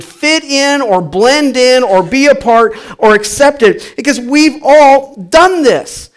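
high-pitched, driving preacher delivery; impassioned preaching. Real, not acted. Preacher confronting congregation about giving in to influence, impassioned. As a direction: preach it with rising urgency.

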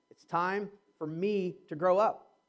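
drawn out slowly, teasing the punchline "It's time for me to grow up."; slow teasing line. Shown as a demonstration. Pastor teasing the congregation with a slow "It's time for me to grow up.". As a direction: savour each word of "It's time for me to grow up." to tease. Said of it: "you thought I was gonna say something else".

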